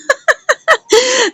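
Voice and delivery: high-pitched laugh. Real, not acted. Recapper reacting to the challenge to go to court, high laugh.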